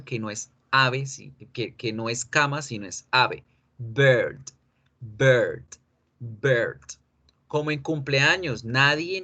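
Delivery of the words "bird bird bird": bird on a drawn-out er, falling; long English er. Shown as a demonstration. Teacher drills bird for students, long English er. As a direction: lengthen the vowel in bird. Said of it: "al alargar la vocal E".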